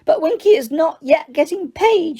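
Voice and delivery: high, squeaky indignant elf. Acted. Winky answers Hermione with an offended squeak. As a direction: squeak it out, hurt and proud. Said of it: "she squeaked".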